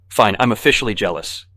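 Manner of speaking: wisecracking. A narrator's reading. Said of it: "McDermott wisecracks".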